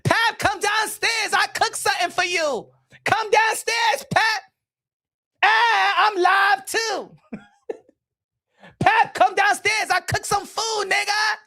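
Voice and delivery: high, slow shouted call; mock wife calling upstairs. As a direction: holler up the stairs for him to come eat. Calling up the stairs.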